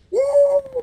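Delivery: high loud woo, blowing off steam; exhaled woo to cool off. Real, not acted. Streamer cooling his temper, loud high woo. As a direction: let out a sharp whoop to calm yourself. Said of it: "Ooh, I gotta calm down".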